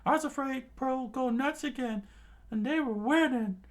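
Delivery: high, loud worried chatter; high crew voice. Acted. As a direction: fret in a squeaky voice.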